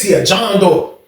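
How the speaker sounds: ordinary talk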